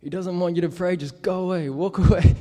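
hammed-up shove-off, half laughing; imitating angry man. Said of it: "started getting real angry".

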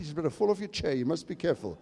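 usual speaking voice

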